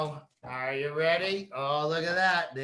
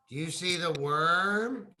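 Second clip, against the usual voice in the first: ordinary delivery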